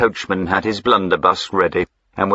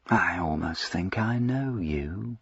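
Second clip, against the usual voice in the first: slow, softer, caressing threat; the duke to Jack, softly menacing; 9 dB quieter, slower than usual. A narrator's reading. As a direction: purr it slowly, softly. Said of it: "he said softly, caressingly".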